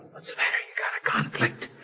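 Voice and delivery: hushed dry whisper; whispered reply. Retold or imitated. Joking to a friend during a funeral, whispering. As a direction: whisper it back deadpan.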